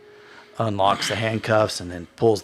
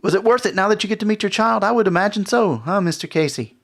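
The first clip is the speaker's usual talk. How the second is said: taunting hostess; 5 semitones higher than usual. Acted. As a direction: toy with them, then pile on in a high voice. Game master plays taunting hostess.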